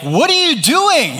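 high-pitched mock outraged wife; acted-out shocked demand; imitating alarmed wife. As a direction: pitch way up, as if catching someone red-handed. Wife catches him, retold.

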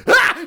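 short, high, loud yelp of surprise; startled scream. Retold or imitated. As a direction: scream as if someone popped up suddenly. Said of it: "who screams in surprise".

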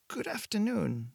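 put-on a calm woman with an accent hard to place; vague European accent. A narrator's reading. Shopkeeper greets Blake in a calm vague European accent. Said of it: "Her accent was impossible to place".

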